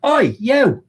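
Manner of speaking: high, loud "oi" called across a track; mock shout. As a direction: imitate blokes hollering at a runner. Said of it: "three blokes going".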